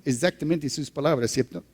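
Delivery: usual speaking voice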